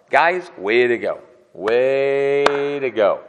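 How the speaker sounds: loud, slow, drawn-out sarcastic praise; mock congratulate them, dripping sarcasm; pure sarcasm. Elihu mocking Job's friends, loud sarcastic praise. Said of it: "This is pure sarcasm".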